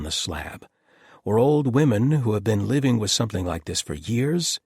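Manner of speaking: worked-up doctor venting; exasperated rant. A narrator's reading. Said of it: "Nobody wants to hear me ranting about this".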